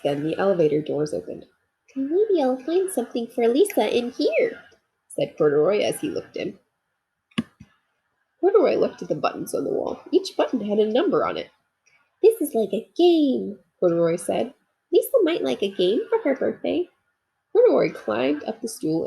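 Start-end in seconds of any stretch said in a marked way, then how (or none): 2.0-4.6 higher, hopeful little-bear voice; a toy bear curious and hopeful; little bear Corduroy. Acted. Corduroy peeking into elevator. 12.2-16.9 a toy bear marvelling at a new game; delighted little bear. Acted. Corduroy playing with buttons.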